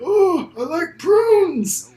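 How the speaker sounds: slow, high mock old man; creaky old geezer, drawn out slowly. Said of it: "old man voice".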